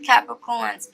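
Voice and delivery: normal talking voice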